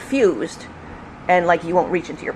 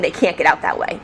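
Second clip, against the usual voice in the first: plain reading voice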